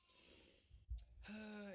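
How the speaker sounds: long exasperated sigh; heavy frustrated sigh. Real, not acted. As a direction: let out a fed-up sigh. After losing trade.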